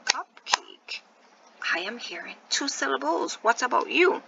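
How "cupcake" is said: quieter, Cupcake in two separate beats; syllable by syllable. Shown as a demonstration. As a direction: softly split Cupcake into CUP and CAKE. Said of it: "let's take our time and say".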